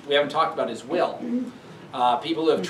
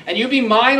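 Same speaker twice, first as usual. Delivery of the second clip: louder, higher furious threat; angry father; 9 semitones higher, 10 dB louder than usual. Retold or imitated. Capulet raging at Juliet for refusing the match, furious shout. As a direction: bellow it at your defiant daughter. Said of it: "he says".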